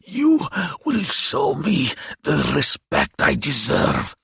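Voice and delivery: high, slow, imperious monster; demanding demon. A narrator's reading. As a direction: demand respect as an old demon. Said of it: "he demanded".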